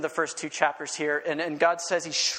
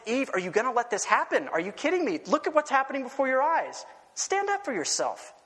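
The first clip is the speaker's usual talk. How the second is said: serpent goading; 8 semitones higher than usual. Acted. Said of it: "He's like".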